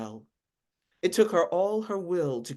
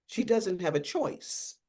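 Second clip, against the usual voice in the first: short, cool and a little soft, no room for argument; curt; 6 semitones higher, 3 dB quieter than usual. Acted. Agent curtly dismisses the lawyer's objection. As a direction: cut her off curtly. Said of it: "said curtly".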